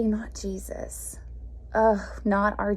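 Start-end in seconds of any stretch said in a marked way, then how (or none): 0.0-1.2 whisper. Real, not acted. Contrast with Jesus' patience.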